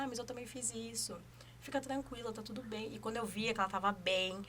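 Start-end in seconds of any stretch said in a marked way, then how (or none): 0.0-2.9 much softer, gentle "it's all fine"; soft reassuring mothers. Retold or imitated. Other mothers reassuring a new mom, gentle and soft. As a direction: soothe her like an experienced mother.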